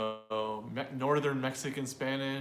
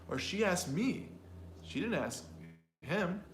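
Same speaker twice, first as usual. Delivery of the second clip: "me" and "him" lifted higher; stress on me and him; 4 semitones higher than usual. Shown as a demonstration. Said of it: "You can emphasize the function words".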